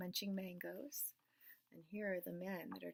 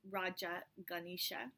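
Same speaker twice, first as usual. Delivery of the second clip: plain reading voice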